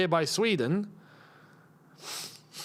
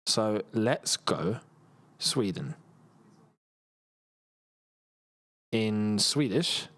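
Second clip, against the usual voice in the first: slow dictation; 8 semitones lower, much slower than usual. Real, not acted. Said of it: "let's get A I involved".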